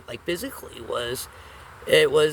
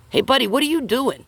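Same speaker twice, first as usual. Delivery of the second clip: higher, louder call to a buddy; Sam calling out; 9 semitones higher, 7 dB louder than usual. Retold or imitated. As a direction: call over, suspicious but casual. Sam catches Frank with gun.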